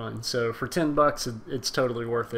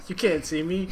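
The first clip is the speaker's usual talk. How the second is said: laughing; 5 semitones higher than usual. Real, not acted. Quoting John Cena catchphrase, laughing.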